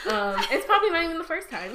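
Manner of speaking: high, loud laughing aside. Real, not acted. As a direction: giggle through the confession. Laughing after her burp.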